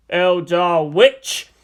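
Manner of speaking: slow, venomous accusation; outraged warrior's drawn-out insult; spat. Acted. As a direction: spit it out word by word. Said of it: "the Space Marine spat".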